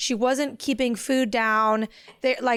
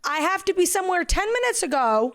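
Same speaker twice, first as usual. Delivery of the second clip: retold frantic reply; 7 semitones higher than usual.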